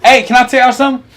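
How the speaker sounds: usual speaking voice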